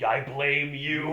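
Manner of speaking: slow, rising-volume mock blame; long, loud playful accusation; drawn-out accusation. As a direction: stretch each word out to accuse a friend. Retelling own accusing retort.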